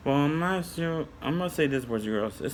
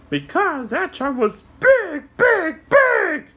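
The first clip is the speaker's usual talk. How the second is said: high squeaky puppet, slow; a little puppet explaining to kids; high cartoon helper voice; 6 dB louder, slower than usual. Puppet answers.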